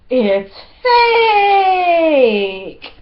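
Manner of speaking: high, loud, drawn-out mock wail; dramatic outcry. Vlogger revealing a counterfeit bag, mock-dramatic outcry. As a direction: stretch "fake" into a hammed-up cry.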